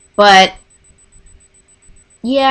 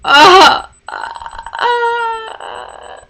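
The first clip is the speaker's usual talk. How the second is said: rising breathless squeals, voice cracking up high; overwhelmed fangirl squeal; 12 semitones higher, much slower than usual. Retold or imitated. Fan hearing the song live, squealing in overwhelmed joy. Reacting to favourite song starting.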